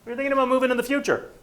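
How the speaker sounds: high, loud, fast salesman pitch; bright doorstep opener; imitating a real estate agent knocking; chirpy door knocker. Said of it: "his door knocking script".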